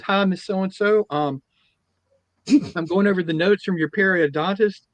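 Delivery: lightly voiced sweet assistant; chirpy assistant. Assistant greeting the old lady, lightly chirpy. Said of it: "dental assistant come there and goes".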